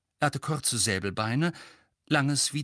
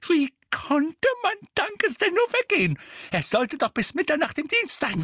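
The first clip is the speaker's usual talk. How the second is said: high, loud, fretful lamenting; agitated Mrs. Figg; 13 semitones higher, 4 dB louder than usual. A narrator's reading. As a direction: fret and fume in a high-pitched voice.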